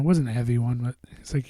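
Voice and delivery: ordinary delivery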